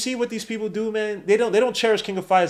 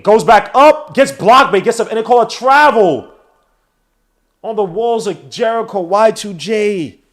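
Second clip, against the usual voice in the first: higher, louder hyped commentary; excited play call; 4 semitones higher, 9 dB louder, slower than usual. Acted. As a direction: yell the play like a huge moment. Big play called out.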